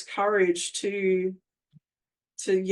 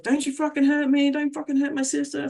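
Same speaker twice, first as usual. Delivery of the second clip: higher, sharp yell at her abuser; re-living the scream at him, pitched up; retold furious yell; 7 semitones higher than usual. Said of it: "started screaming at him".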